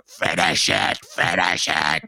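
low, loud goblin voice; mock goblin snarl. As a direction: growl it like a menacing villain. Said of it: "and he's like".

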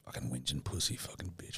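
very quiet, low muttered insult; grumbling under breath. Real, not acted. Man grumbles at partner during a breather, muttered under breath. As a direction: mutter it almost inaudibly.

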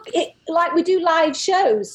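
ordinary delivery

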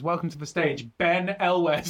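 lightly imitated club MC, building to the name; retold emcee intro, ending high and laughing; MC stage introduction. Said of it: "an M C was so paranoid".

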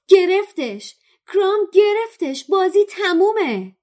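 high, loud shout. Acted. Harry shouting that Krum caught the snitch, high loud shout. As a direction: announce the catch at full voice. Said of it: "هری فریاد زد".

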